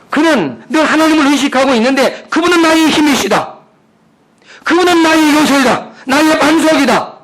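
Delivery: high, driving pulpit voice; forceful preaching. Real, not acted. Preacher telling the congregation God is watching, forceful. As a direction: proclaim it with rising conviction.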